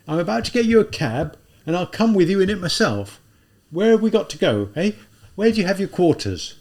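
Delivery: put-on an older policeman clucking over the girl; policeman character.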